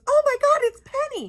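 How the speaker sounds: high, loud cry of dawning horror, breaking into laughter; shocked recognition. Retold or imitated. Friend realizing the filthy dog is hers, shocked. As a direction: retell it as a loud, high shriek.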